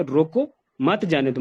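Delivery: "रोको", long pause, then "मत जाने दो"; full stop after रोको. Shown as a demonstration. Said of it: "रोको के बाद फुल स्टॉप लगाया". ("रोको" पर रुककर "मत जाने दो")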